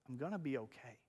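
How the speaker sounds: soft, low, quietly reassuring; calm reassurance. Retold or imitated. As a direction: imitate a calm wife soothing her husband. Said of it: "but she's calm".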